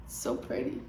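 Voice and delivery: whispered. Real, not acted. Admiring book cover up close.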